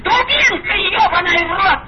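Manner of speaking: furious shrieking, cursing through laughter; foul mouthed granny. Real, not acted. Furious old woman screaming on phone.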